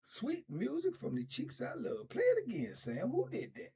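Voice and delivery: lightly voiced creepy cellmate; mocking creepy inmate. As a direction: croon it like a leering admirer. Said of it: "They be making statements like".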